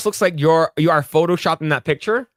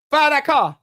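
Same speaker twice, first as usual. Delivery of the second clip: movie quote; 9 semitones higher than usual. Retold or imitated. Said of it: "he's like".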